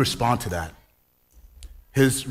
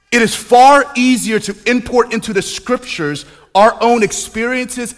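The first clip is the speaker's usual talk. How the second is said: higher and louder, rhythmic and near-sung; fervent preaching; 9 semitones higher, 8 dB louder than usual. Acted. Pastor warns the congregation in a rising full-force sermon. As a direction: drive each phrase home from the pulpit.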